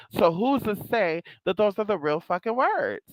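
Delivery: usual speaking voice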